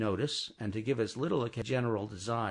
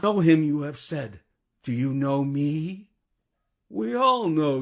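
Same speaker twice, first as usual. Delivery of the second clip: stern revolutionary guard; 5 semitones higher, 7 dB louder than usual. Acted. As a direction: confront him as a hard citizen.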